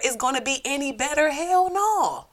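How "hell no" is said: emphatic. Real, not acted. Rhetorical answer.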